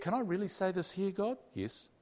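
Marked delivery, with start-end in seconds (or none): 1.5-1.9 a low, soft yes; soft answer. Retold or imitated. God answering the pleading man, low and soft. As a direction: say it gently and short.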